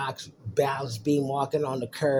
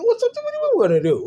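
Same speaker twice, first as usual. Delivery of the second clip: high squeaky mocking voice, fast; 7 dB louder, faster than usual. Dad mocking the question in a high squeaky voice. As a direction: squeak it out like a cartoon.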